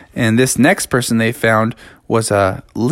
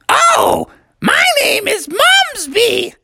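put-on very high, squawky little frog girl; crazy old-person voice; slower than usual. A narrator's reading. Girl frog introduces herself to Froggy, crazy old-person voice. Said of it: "you still talk in that s-, crazy voice".

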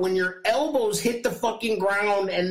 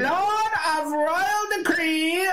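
town crier; 10 semitones higher than usual. Pretend. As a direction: cry it out to the whole kingdom. Mock royal decree, "hear ye.

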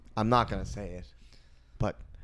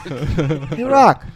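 "Hey, Rock" jumping to a loud, high ROCK; teasing greeting; 6 semitones higher, 9 dB louder than usual. Shown as a demonstration. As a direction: keep teasing, shoot ROCK way up. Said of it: "Please, please stop saying".

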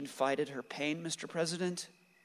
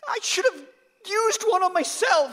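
screaming in anguish; 10 dB louder than usual. Acted. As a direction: scream the guilt out until the voice cracks. Son screams guilt at mother.

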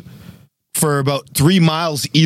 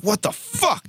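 sudden jolted "what the fuck", pitched way up; startled outburst; 13 semitones higher, 5 dB quieter than usual. Retold or imitated. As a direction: caught off guard, blurt it out. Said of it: "I'm like".

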